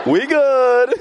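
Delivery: laughing it out, high and bright. Real, not acted. Laughing to the congregation about his wife's okay. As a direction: break into laughter on it.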